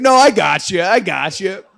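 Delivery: a hammed-up friend yelling to the screaming dancer; reassuring shout. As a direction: call out over her screams that you've got her. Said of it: "He's like".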